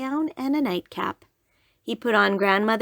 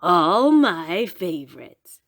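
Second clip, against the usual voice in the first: sly wolf voice; 3 semitones lower, 5 dB louder, slower than usual. Acted.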